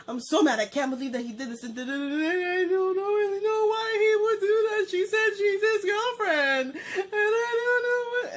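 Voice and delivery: high, loud tearful venting; crying. Retold or imitated. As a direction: sob it out to your cousin. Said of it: "then I started crying".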